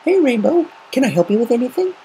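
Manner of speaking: a cheerful, helpful high voice; Twilight's voice. A narrator's reading. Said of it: "turned and smiled".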